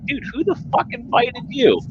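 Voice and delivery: high mock-disbelieving voice; incredulous retort. High scoffing voice aimed at the gatecrashing rival. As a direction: pitch it up, scoffing at him. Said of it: "And it's like".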